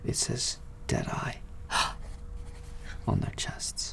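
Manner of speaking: soft unvoiced whisper with a gasp. Gossiping man whispering the carved name to the party, with a gasp. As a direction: breathe the name out in dread.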